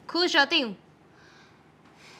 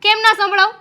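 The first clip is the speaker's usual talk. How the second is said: angry retort; 6 semitones higher, 5 dB louder than usual. Acted. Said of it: "આવી રીતે ગુસ્સો ના કર".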